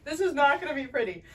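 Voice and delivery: giggling at the awkward moment; doctor laughing as the couple's row begins. Real, not acted.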